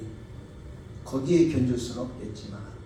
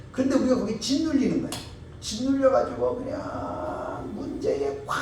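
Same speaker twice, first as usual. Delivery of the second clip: raised, emphatic preaching; 9 semitones higher, 5 dB louder than usual. Acted. Pastor urging the congregation not to sink under trials, raised and emphatic. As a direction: press the point home to the congregation.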